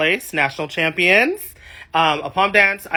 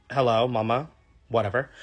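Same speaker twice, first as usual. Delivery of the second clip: ordinary delivery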